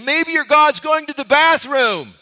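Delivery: loud, high, jeering insult; crude taunt. Retold or imitated. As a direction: sneer it with open contempt. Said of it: "the most disrespectful thing you could ever say".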